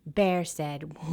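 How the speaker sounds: ordinary delivery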